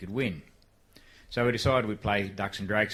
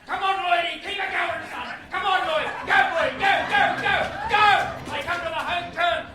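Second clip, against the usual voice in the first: much higher and louder, shouting the horse home; urging your own horse over the line at full pitch; excited race caller screaming at jockey Lloyd to win; frantic race call; 7 dB louder than usual. Retold or imitated. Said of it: "And I went something like this".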